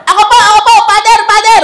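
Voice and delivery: put-on high, loud little-child voice; shouting four-year-old. Child begs father to stop wedding, urgent shouting. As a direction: pipe up urgently like a small kid. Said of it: "four-year-old na bata sumigaw".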